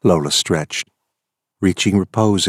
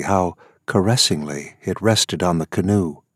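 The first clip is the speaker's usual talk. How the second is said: plain reading voice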